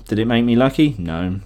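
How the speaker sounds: ordinary talk, nothing marked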